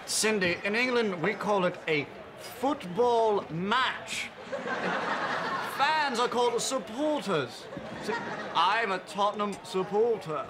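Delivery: fake British accent. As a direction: put on an English accent to impress. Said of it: "just trying to trick you into bed".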